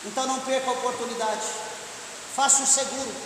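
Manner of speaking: hushed closing altar call; earnest appeal. Real, not acted. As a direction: bring it down to a quiet, sincere plea.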